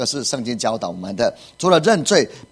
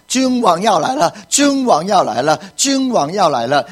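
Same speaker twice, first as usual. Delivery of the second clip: raised, higher-pitched repeated announcement; herald's proclamation; 5 semitones higher, 3 dB louder than usual. Acted. Messenger telling everyone the king is coming, loud proclamation. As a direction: call it out like a royal messenger. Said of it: "必须要使者大声地喊出来".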